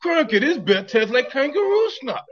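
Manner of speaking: high, loud hammed-up Australian accent. Host repeats after "What?", loud high mock Australian accent.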